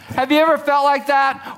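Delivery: ordinary delivery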